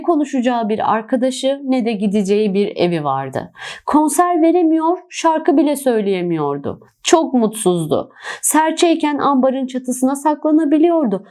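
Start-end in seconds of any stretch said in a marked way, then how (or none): none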